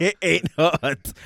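slow, loud laughing; drawn-out chuckling agreement. Real, not acted. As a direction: laugh the words out.

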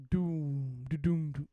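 booming "doom, da-doom" bass imitation; drawn-out bass beats done by mouth; imitated subwoofer thump. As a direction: mimic a car sub thudding.

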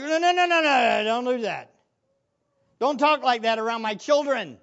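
loud, rapid-fire objection easing into firm reproof; retold scolding. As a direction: act out cutting someone off in protest. Retells scolding the students.

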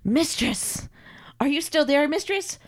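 high, rising, plaintive call; needy servant calling out. Acted. Carl wonders if his mistress left, plaintive. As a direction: call anxiously for your mistress.

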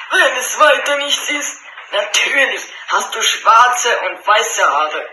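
slow, sure of himself; a hint of a cocky tailor; lightly voiced tailor. First tailor answers. (leicht gespielter Schneider)

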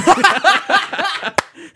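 loud, high burst of laughing; big high-pitched laugh; laughter. Real, not acted. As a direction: explode into a cackle. Cracking up at N-bomb joke.

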